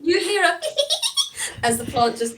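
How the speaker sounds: high, loud through laughter; laughing. Real, not acted.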